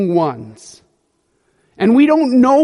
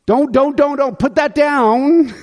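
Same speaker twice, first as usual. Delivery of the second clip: mock parent scold; 7 semitones higher, 3 dB louder than usual. Parent scolding kids in sermon.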